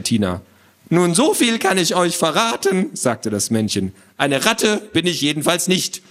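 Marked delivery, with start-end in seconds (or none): 0.9-2.9 high, self-important little man; squawky know-it-all. Acted. Little man introduces himself to children, squawky. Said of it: "eine quäkende Stimme". 4.2-6.0 high, self-important little man; squawky know-it-all. Acted. Little man introduces himself to children, squawky. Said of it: "eine quäkende Stimme".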